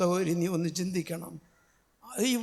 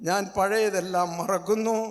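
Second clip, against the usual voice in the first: ordinary talk, nothing marked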